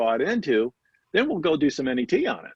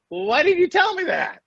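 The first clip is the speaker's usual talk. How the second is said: high-pitched, hammed-up protest; mock indignant; 13 semitones higher, 4 dB louder than usual. Client protests to her mentor about late advice, mock indignant. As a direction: squeal it as a comic complaint.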